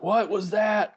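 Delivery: high, loud startled question; surprised. Retold or imitated. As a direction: shout it in sudden alarm. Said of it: "everybody's like".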